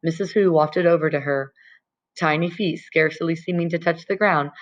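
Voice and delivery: plain reading voice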